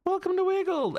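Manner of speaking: very high put-on voice; playful high welcome. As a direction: squeal out a silly welcome.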